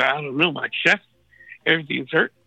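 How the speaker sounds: usual speaking voice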